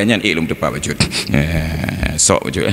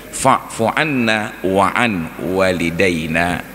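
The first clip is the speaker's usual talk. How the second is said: slow careful recitation; much slower than usual. Shown as a demonstration. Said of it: "Sedangkan ghafara".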